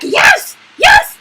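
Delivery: high-pitched excited squeal, imitated; mock a thrilled onlooker's shrieks; excited cheering. Penelope egging on the fight, high excited cheer.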